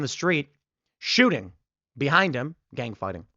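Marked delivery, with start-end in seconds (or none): none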